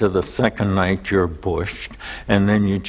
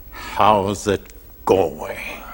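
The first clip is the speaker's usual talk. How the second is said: retold whisper; 2 semitones lower, much slower than usual. The roshi greeting the raging student in a slow whisper. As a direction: hush it out slowly, as if the teacher barely breathes the words.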